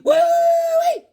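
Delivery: very high, loud falsetto whoop; high cowboy whoop. Pretend. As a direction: let out a long squealing cowboy holler.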